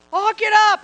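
high, loud playful nagging; husband and wife squabbling at home, mock loud; mock bickering. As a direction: toss it at your husband teasingly. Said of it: "it can be like".

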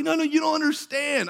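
high-pitched imitation of insistence; urgent protest. Healed woman correcting the preacher, high excited protest. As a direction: voice her urgent need to explain.

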